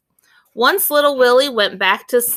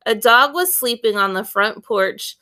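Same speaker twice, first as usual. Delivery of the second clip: plain reading voice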